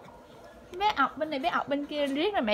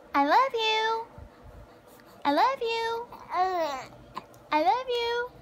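higher still, slow and drawn out; coaxing singsong; 7 semitones higher, 3 dB quieter, slower than usual. Shown as a demonstration. Mother urging her baby to repeat it, high singsong. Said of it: "Ngày nào mình cũng học cái câu này hết".

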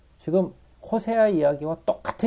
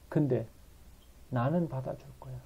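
tender promise; 8 dB quieter than usual. Acted. God softly promising.

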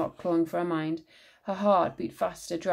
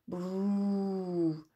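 rolling purr trill; cat purr; 4 dB quieter than usual. A narrator's reading. Cat answering from the dark.

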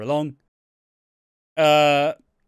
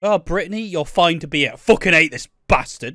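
louder, higher sulky rant; mock bitter character; 4 semitones higher, 8 dB louder than usual. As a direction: grumble at the kid. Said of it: "It's like".

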